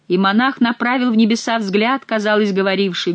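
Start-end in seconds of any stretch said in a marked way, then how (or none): none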